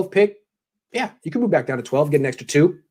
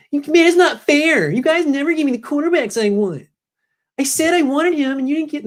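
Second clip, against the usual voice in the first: mock a coach as a sulking fifteen-year-old; Kyle Shanahan; 12 semitones higher than usual. Host plays coach complaining about quarterbacks, very high mock whine. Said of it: "People treat Kyle Shanahan like he's fifteen".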